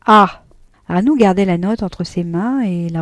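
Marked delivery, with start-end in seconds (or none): 0.1-0.4 loud, bitter ironic "Ah!"; wife reacting to his lie with pained irony. A narrator's reading. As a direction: let pain and irony ring together. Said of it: "une intonation de voix singulière, où il y avait de la douleur et de l'ironie".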